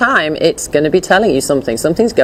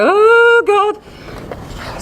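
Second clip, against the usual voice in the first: dog's dismay. Retold or imitated. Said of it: "He's like".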